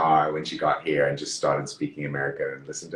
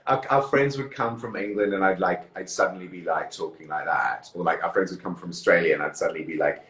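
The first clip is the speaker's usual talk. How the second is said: ordinary talk, nothing marked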